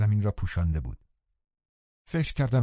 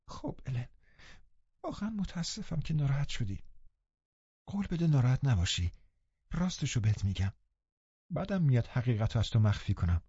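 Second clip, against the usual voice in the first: put-on higher, softer girl near tears; girl crying on her nurse's neck, apologising; tearful apology; 3 semitones higher, 6 dB quieter than usual. A narrator's reading. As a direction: plead for forgiveness through tears. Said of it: "زد زیر گریه".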